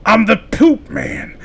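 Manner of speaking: slow, drawn-out wrestler persona; gimmick voice. Pretend. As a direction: announce yourself like a ring character. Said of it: "That's my gimmick".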